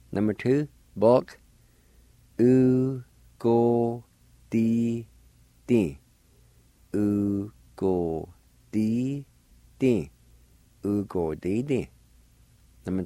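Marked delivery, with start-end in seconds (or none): none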